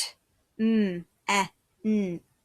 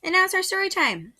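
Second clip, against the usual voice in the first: bright and quick, much higher; cheery announcement; 10 semitones higher, 5 dB louder, much faster than usual. Real, not acted. Teacher announcing story time to the class, cheery.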